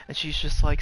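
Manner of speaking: usual speaking voice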